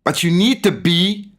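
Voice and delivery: rising fervour, voice pushed way up; getting emotional. Real, not acted. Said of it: "Hmm, I'm getting emotional".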